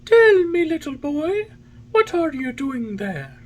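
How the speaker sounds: very high pigeon call, loud at the start. A narrator's reading. Pigeon flying above calls down to the marionette, crying out. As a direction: cry out high, then ease off. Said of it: "he cried to him".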